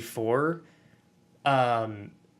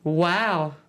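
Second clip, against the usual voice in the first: a lightly voiced, somewhat higher wow; a hint of the cashier's high voice; gentle mock of the guy's wow; high-pitched sweet guy; 4 semitones higher, 3 dB louder than usual. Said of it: "Talk like this".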